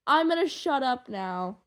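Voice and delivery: usual speaking voice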